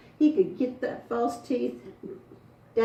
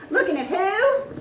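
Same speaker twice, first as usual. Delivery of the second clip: higher, slow call-out; calling to audience; 10 semitones higher than usual. Real, not acted. Storyteller urges sleepy audience to respond, calling out. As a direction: prompt the crowd to answer. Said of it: "help me out".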